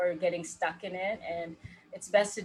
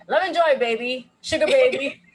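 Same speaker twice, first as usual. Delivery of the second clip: high, loud, playful sing-song with a laugh; 6 semitones higher, 11 dB louder than usual. Real, not acted. Coach playfully riffing on her own phrase, laughing. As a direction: tease it out like a cheeky endearment. Playful riff on love and joy.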